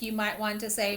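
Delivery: ordinary talk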